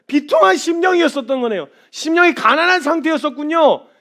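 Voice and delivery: high, loud, overwhelmed realisation; loud fervent confession. Retold or imitated. Believer answering the Lord, loud relieved confession. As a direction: cry it out in relief. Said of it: "표현해 주시는 이게".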